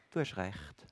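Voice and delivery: normal talking voice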